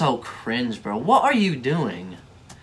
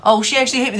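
high, louder mocking whine; mocking quote; 10 semitones higher, 5 dB louder, much faster than usual. Vlogger mocking the TikToker who got hit, whiny mock voice. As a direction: parrot her complaint back with scorn.

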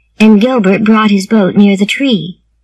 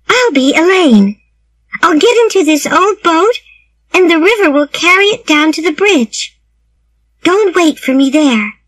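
put-on high, eager young girl; a girl excitedly proposing a game; Anne's voice; 6 semitones higher than usual. A narrator's reading.

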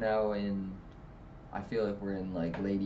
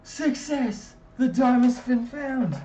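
high, loud triumphant yelling; overjoyed high yell; excited shout; 8 dB louder than usual. Retold or imitated. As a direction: shout it like you struck gold. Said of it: "and I said".

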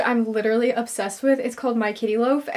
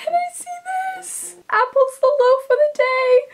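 high and louder, overjoyed; can't contain the excitement; thrilled squeal; 12 semitones higher, 4 dB louder than usual. Real, not acted. Cat got loaf of the day.

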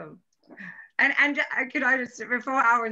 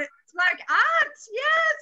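excited; slower than usual. Real, not acted. Delighted about painting idea.

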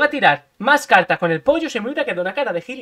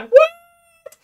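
high, loud squeaked What; mock the baffled opponent; stunned enemy; 8 dB louder than usual. Gamer imitates the stunned enemy's reaction. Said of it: "Se ha quedado en plan".

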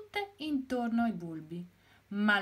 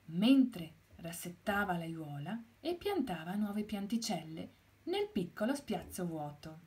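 plain reading voice

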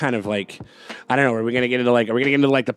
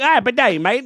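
mock Aussie accent; 10 semitones higher, 4 dB louder than usual. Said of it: "It's like".

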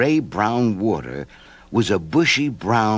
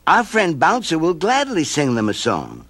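put-on a touch higher boy voice; lightly voiced boy; 6 semitones higher than usual. A narrator's reading. Stevie explaining to the mope, lightly higher.

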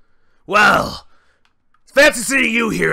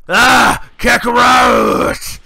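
drawn-out rage roar; 5 semitones higher, 6 dB louder, much slower than usual. Acted. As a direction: stretch Kakarot! into a slow furious roar, louder. Broly roaring at rival.

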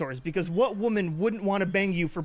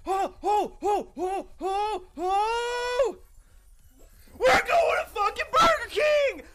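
ecstatic victory yell; 12 semitones higher, slower than usual. Real, not acted. Streamer screams to friends after winning the round, ecstatic yelling. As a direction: scream it like you just won everything.

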